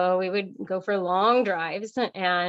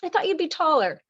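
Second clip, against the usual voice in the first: higher, lightly voiced dry tease; teasing quip; 9 semitones higher, faster than usual. Husband teasing her on first meeting, light mock voice. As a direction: toss it off as a cheeky first line.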